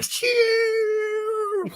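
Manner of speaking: high shrill "Shoo" screech noise; tire screech. Retold or imitated. Man retelling to friends, imitating the truck's screech.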